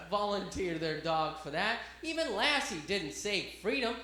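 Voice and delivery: ordinary talk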